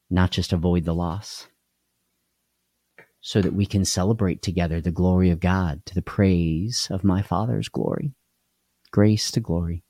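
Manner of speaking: ordinary talk, nothing marked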